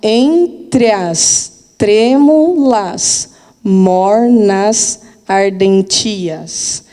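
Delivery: "entre as trêmulas, mornas, ardentias" read very slowly, syllable by syllable; slow scansion reading. Shown as a demonstration. Teacher scanning a verse for students, very slow. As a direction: stretch each syllable out to scan it. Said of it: "Então, ó".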